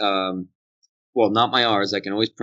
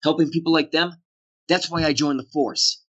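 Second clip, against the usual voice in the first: lightly Boston line, pitched up; Boston accent; 6 semitones higher than usual. Retold or imitated. Actor reenacting his retake with a Boston lilt. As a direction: dodge the word cop in the Boston accent. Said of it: "I said".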